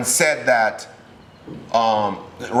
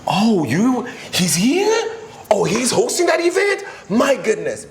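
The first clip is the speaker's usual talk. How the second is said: much higher, thrilled exclamations; admiring gasp; 10 semitones higher, slower than usual. Retold or imitated. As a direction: gush like a starstruck guest. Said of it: "let it be said".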